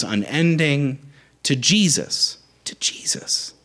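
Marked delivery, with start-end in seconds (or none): 2.6-3.5 breathy whispered close. Acted. Preacher ending the call in a whisper. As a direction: let it fall to a bare whisper. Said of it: "closing the rally refrain".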